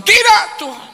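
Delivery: high, loud mock prayer; counter-prayer. Husband prays against his wife, high and loud. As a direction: shout your prayer back at hers. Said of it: "ribut terus di dalam doa".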